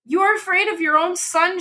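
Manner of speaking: high, sharp accusing cry. A narrator's reading. Wife accusing her husband of fearing their son, high sharp cry. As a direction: throw it at him as a reproach. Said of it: "she cried".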